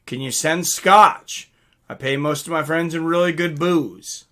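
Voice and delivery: ordinary delivery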